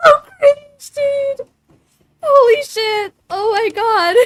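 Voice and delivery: high, loud, helpless laughing; losing it laughing. Real, not acted. Streamer cringing at the Valley Girl request, losing it laughing. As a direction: squeal it through laughter, embarrassed. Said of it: "I'm losing it".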